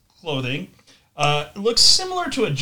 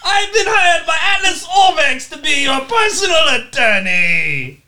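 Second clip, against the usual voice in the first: loud, high-pitched hammed-up lawyer; Mom Joe; 12 semitones higher, 8 dB louder than usual. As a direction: bellow a greeting as an over-the-top attorney. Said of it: "and he goes".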